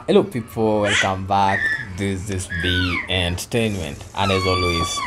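slow, low, soft announcer voice; deep movie-trailer channel intro, slowed down; slow hushed announcer read of the channel slogan. Acted.

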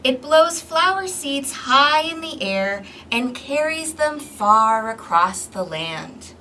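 ordinary delivery, nothing marked

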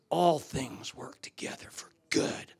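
low, soft, half-whispered scripture; hushed recitation. Acted. Preacher recites verse against regret, hushed.

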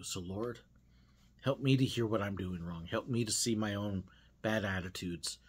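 soft, quiet prayer; closing prayer. Acted. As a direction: pray gently, humbly.

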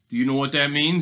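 lightly voiced storybook villain; gloating robber. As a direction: ask it with relish. Robber gloats over the bell.

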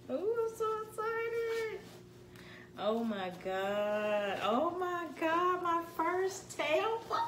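high, slow, gushing squeal; excited squealing. Real, not acted. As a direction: melt over the bag in delight. The big reveal of bag.

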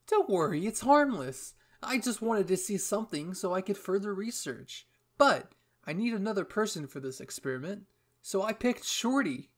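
high, loud breezy reassurance; cheerful eccentric commander. Acted. Hanji picking a test subject, high showy cheer. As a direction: brush off the worry with showy cheer.

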